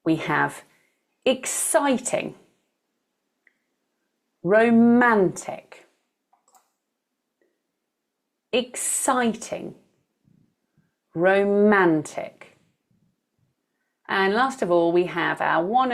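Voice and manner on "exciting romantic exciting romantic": exCIting, roMANtic, slow with the middle syllable stressed; exciting, romantic as slow models, weight on CI and MAN. Shown as a demonstration. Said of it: "stress is in the middle of the word".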